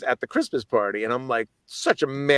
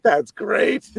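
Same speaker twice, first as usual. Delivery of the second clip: amused exclaim; 10 semitones higher, 5 dB louder than usual. Retold or imitated. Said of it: "I was like".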